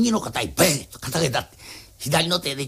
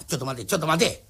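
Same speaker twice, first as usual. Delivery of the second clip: ordinary delivery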